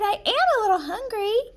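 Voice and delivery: put-on high, quick girlish voice; a trusting girl admitting her hunger; innocent princess.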